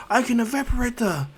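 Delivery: high-pitched defiant cry; acted defiance. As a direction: push it up high in mock bravado. Villain boasts against the rain.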